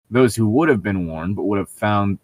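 usual speaking voice

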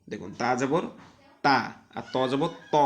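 usual speaking voice

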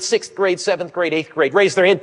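ordinary delivery

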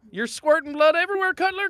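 loud. Real, not acted. Killer taunting a wounded survivor.

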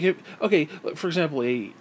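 normal talking voice

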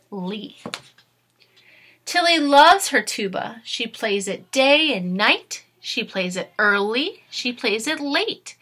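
lively storybook read-aloud; reading to children. Acted. Teacher reading a storybook aloud to her class, lively. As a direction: read it brightly to young listeners. Reads picture book to class.

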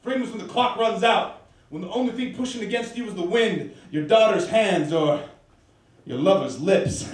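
worker's heartfelt counsel, easing off toward the end; a hint of a preaching steel driver; lightly voiced John. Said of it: "John's voice was as holy as the blue breeze".

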